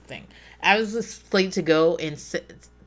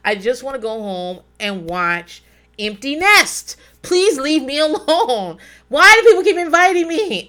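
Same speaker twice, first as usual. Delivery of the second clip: slow, then higher and louder mock wail; whining protest; 5 semitones higher, 5 dB louder than usual. Dreading the event while driving, building mock wail. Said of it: "I'll be like".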